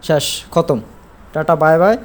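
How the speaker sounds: ordinary talk, nothing marked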